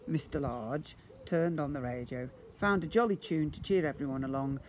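ordinary delivery

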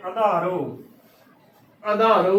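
ਆਧਾਰੋ starting on short "a", like "adhaaro"; wrong short-vowel reading. Shown as a demonstration. Teacher showing the congregation's mistake, ਆਧਾਰੋ with short first "a". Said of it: "ਐੜਾ ਮੁਕਤਾ ਕਰ ਦਿੰਦੀਆਂ".

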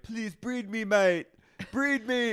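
high, pleading British-bloke mimicry; mock James pleading. Friend mocking a reality star begging to be bred, high squeaky plea. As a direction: beg it in a squeaky hammed-up voice. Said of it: "James is like".

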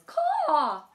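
high squawk then bossy call; raven calling. Acted. Raven calling Owl and Wren to fetch herbs, squawking. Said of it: "Raven says".

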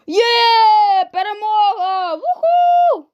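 loud, high triumphant yell; victory shout. Real, not acted. Gamer celebrating a round win, shouting loudly. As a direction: whoop at the win.

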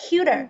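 "Cuter" with the t turned into a light d; soft d in cuter. Shown as a demonstration. Teacher showing the student the light d in "Cuter". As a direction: flap the t in "Cuter" gently. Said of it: "Cái chữ tờ thành chữ đờ nhẹ".

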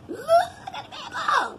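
very high-pitched, giddy squeal; delighted squeal. Real, not acted. Vlogger showing off her Lush gift, squealing with delight.

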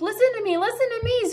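high squawk without much volume; goose screaming. A narrator's reading. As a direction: demand attention as a high-pitched goose. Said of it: "screamed the goose".

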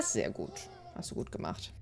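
whispered little pat on the back; whispered praise. Real, not acted. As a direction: breathe the praise without voice. Praising a Sim baby.

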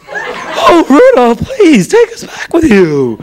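high, effeminate pleading; hammed-up effeminate cartoon toy; Charlie in the Box. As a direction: swoop up high and beg to be rescued. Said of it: "first effeminate character ever in cartoons".

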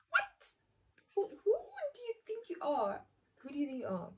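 squeaky high, indignant; outraged disbelief. Retold or imitated. Narrator imitating herself confronting her drunk friend, high indignant. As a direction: shoot it up high like she's gone too far.

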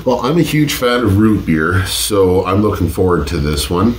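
normal talking voice

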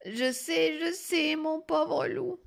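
high, drawn-out granny sigh; sighing grandmother. A narrator's reading. Grandmother pities the poor wolf, sighing. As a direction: sigh it with weary sympathy. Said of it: "soupira la grand-mère".

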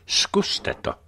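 whispered, unvoiced repeat; hushed fatalistic dare. A narrator's reading. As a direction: breathe the dare out.

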